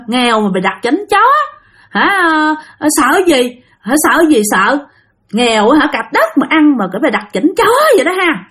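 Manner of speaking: acted high-pitched taunting; drunken cursing. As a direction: sneer at them like a tipsy braggart. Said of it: "ông Sáu Xị này mới chửi".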